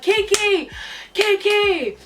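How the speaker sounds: high, loud call for help; retold scream. Screaming for best friend to come, high urgent call. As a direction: call out her name in a high holler. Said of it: "I start screaming for my best friend's name".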